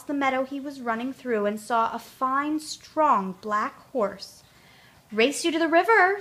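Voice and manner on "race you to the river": higher and louder, calling out a dare; shouted challenge. A narrator's reading. Horse shouting a race challenge to Tootle, loud. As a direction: shout it over the meadow.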